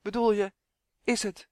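faltering horror. A narrator's reading. Elf cannot voice the horrible truth, faltering. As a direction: start to ask and fail to finish. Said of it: "Het lukte hem niet om het afschuwelijke uit te spreken".

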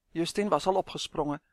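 plain reading voice

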